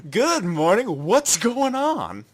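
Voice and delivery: loud, high, drawn-out cry; Nobuaki's greeting. Retold or imitated. Podcaster mocking Nobuaki's clueless line, loud and high-pitched.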